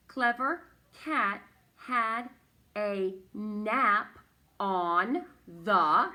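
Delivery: loud, slow, each word set apart; teacher modelling careful reading, word after word. Shown as a demonstration. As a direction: read it out firmly, pausing between words.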